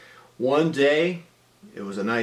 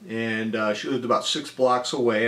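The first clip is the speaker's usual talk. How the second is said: ordinary delivery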